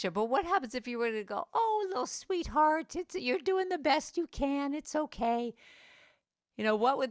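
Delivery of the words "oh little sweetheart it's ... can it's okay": put-on high, slow, tender coo to a child; gentle higher voice like soothing a child; tender self-soothing. Shown as a demonstration.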